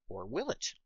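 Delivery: hushed, teasing question; ominous tease. Acted. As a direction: drop to a hush, tease it. Teasing villain return.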